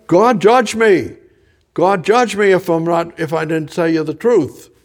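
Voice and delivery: loud, high oath of a cornered man; Peter cursing. Acted. Peter swears loudly to the bystanders that he is telling the truth. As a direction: swear it out loud, calling down God's judgment. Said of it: "I call down".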